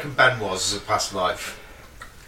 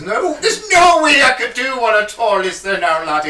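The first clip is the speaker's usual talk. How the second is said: mock Irish leprechaun voice, much higher and louder; jokey full-on leprechaun Irish accent; 8 semitones higher, 5 dB louder than usual. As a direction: ham up a stage Irish accent, laughing through it. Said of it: "I went full on leprechaun at".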